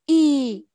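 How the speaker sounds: Y held long and loud as "ee"; long vowel. Shown as a demonstration. Teacher reminding class of the long sound, Y held loud. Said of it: "đó là âm y dài".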